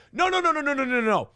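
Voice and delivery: quick, high protesting nos; laughing protest, no no no; rapid no no no. Real, not acted. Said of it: "reacting to chat".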